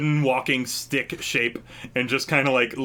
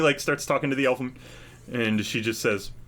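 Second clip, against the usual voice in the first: usual speaking voice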